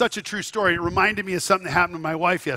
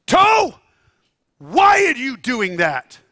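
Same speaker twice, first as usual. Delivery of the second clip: sudden high shout at the toe; a father shouting down at his hurt toe; yelling at his toe; 5 semitones higher, 7 dB louder, slower than usual. Retold or imitated. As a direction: bark at the toe, then drag out the question slowly.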